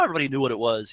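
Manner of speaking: ordinary talk, nothing marked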